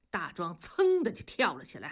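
ordinary delivery, nothing marked

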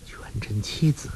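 breathy whispered musing; inner thought. A narrator's reading. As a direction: whisper it to yourself, as a private thought. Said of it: "心说". (心里暗自嘀咕的气声)

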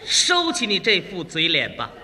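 low-pitched command; stern husband. Acted. Husband silences his crying wife, stern. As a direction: put on a firm, manly authority.

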